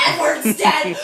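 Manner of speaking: Edward's dead hurled at the class; screamed news. Retold or imitated. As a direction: yell Edward's dead at them. Screamed in rant.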